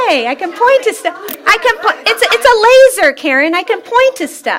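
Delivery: high, gleeful discovery; delighted, playful outburst, pitched high; giddy excitement. Real, not acted. Playing with newly found laser pointer.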